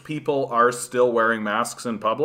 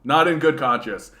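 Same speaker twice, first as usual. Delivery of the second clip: normal talking voice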